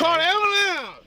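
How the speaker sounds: urgent yell. Real, not acted. Detained man demanding help from police, urgent yell. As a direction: yell it out to be heard.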